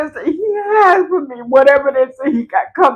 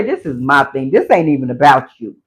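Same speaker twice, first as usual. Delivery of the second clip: voicing God; 11 semitones lower than usual. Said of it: "God say".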